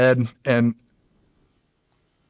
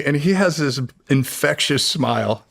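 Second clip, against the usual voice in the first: ordinary talk, nothing marked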